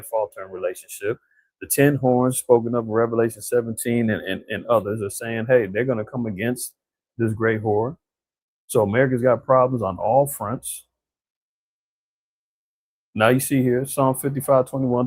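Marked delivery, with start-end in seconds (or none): none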